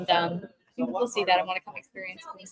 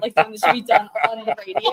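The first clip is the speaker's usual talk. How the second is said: laughing it off, a little louder; embarrassed laugh; 4 semitones higher, 10 dB louder, faster than usual. Real, not acted. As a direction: giggle through the words, embarrassed. Embarrassed at accent request.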